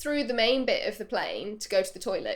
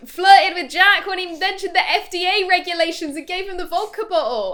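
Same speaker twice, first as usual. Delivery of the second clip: excited; 7 semitones higher, 7 dB louder than usual. Real, not acted. Excited recap of scene.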